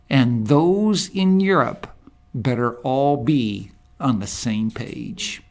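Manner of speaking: ordinary talk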